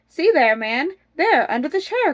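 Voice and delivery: high, loud pointing-out; exasperated storybook wife, lightly acted; crying out. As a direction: direct him to it urgently. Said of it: "cried his wife".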